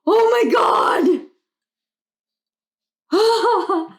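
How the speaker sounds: high, loud drawn-out oh my God; stunned joyful outburst; excited exclamation. Real, not acted. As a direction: cry out in real amazement. Reacting to cards, excited.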